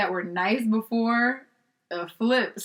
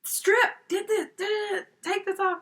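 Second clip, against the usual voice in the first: high-pitched imitation of impatient orders; snippy retold commands, not shouted; mock angry; 12 semitones higher than usual. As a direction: play the annoyed organizer hurrying a model. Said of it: "never seen you so angry".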